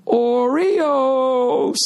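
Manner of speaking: very high, drawn-out teasing cry; mocking singsong shout. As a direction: imitate a man crowing it across a lobby. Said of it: "like he was shitting on me the way he went".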